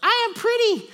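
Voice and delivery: put-on very high, loud kid voice; little girl's proclamation. Little daughter declares it to dad, high loud shout. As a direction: squeal it like a small child. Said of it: "she just goes".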